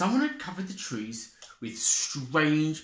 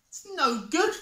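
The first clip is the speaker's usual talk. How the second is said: much higher, despairing Deer. Acted. Deer gives up on digging, high and despairing. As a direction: whine it helplessly. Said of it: "said Deer".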